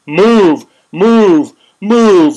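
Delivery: loud barked move, each word hammered; stormtrooper orders. Retold or imitated. Mocking riot police as stormtroopers, loud barked commands. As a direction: shout it like a stormtrooper advancing. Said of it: "Stormtroopers coming at you".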